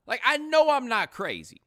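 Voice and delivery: high, loud protest; exasperated insistence. Retold or imitated. Insisting the friend's accent is new, exasperated. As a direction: hammer KNOW loud and high, then fall.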